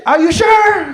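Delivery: high, loud questioning shout; yelled doubt. Acted. Sergeant doubting order under fire, high loud yell. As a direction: holler back, unconvinced. Yells back doubt in firefight.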